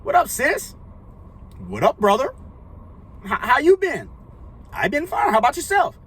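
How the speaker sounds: high-pitched, mock-awkward small talk; hammed-up awkward pleasantries; awkward greeting. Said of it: "be like that awkward ass".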